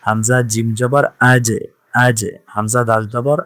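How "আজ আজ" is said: আজ with a pushed, released final j; আজ, the last consonant popped into a short echo; qalqalah bounce. Shown as a demonstration. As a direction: bounce off the closing j each time in আজ. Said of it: "আওয়াজটা একটু ধাক্কা দিয়ে পড়তে হয়".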